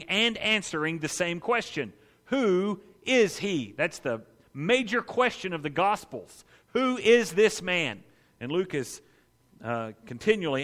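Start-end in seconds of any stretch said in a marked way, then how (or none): 2.3-3.7 WHO IS HE, slow and raised in pitch; "Who is he" as a big, spaced-out question; emphatic question. Acted. Preacher repeats the major question, emphatically. 6.7-8.0 WHO IS THIS MAN, slow and slightly raised; weight each word of "Who is this man"; "Who is this man" spelled out firmly, word by word; emphatic question. Acted. Preacher repeats the major question, emphatically.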